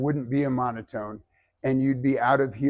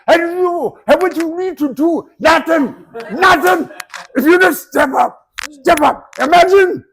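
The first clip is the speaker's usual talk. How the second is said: high, loud, hammed-up Brazilian accent; imitating a thick Brazilian accent, pumped up; 13 semitones higher, 11 dB louder than usual. Coach imitating Brazilian mentor's thick accent, loud and high. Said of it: "Roberto's Brazilian accent was so fucking thick".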